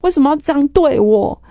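anguished cry of why; retold yell. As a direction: shout the why at the sky. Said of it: "我就一直喊".

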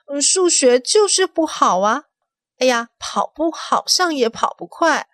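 put-on lightly whining kid; little girl voice. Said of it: "青青姐姐小时候啊".

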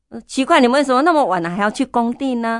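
suddenly suspicious and pressing; alarmed surprise. Retold or imitated. Mother alarmed by late calls. (吃惊又着急地追问)

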